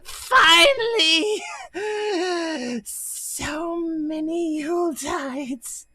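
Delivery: very high, loud, slow gloating cry; crazed Santa. Acted. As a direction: gloat like a deranged Santa finding his prey. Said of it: "And he goes".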